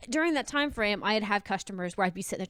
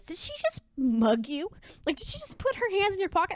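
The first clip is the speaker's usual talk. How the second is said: boss in disbelief; 10 semitones higher than usual. Retold or imitated.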